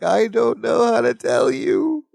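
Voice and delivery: slow, rising sing-song reluctance; hemming sing-song. Retold or imitated. Imitating Balky stalling before bad news, sing-song. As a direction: draw it out high and singsong, stalling. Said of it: "Balky's hemming and hawing and sing-songing".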